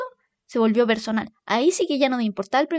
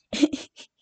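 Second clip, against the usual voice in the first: breathless laughter; 4 dB quieter than usual. Real, not acted. Laughing at sleeping mom.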